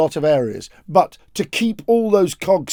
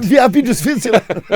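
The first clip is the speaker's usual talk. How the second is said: laughing through it; 6 semitones higher, 4 dB louder than usual. Acted. Father voice, laughing.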